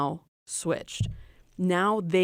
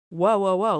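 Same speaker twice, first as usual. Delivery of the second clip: mock alarm; 3 semitones higher, 7 dB louder than usual. Real, not acted. Stopping herself mid-story.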